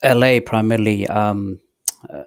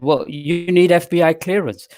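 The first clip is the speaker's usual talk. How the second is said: mock official; 9 semitones higher, 4 dB louder than usual. Retold officials demanding clearance.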